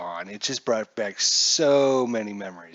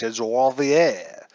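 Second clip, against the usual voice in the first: Lavier slow, with a French accent; 4 dB louder, slower than usual. Shown as a demonstration. Teasing the chat, mock French accent. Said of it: "give it a French accent".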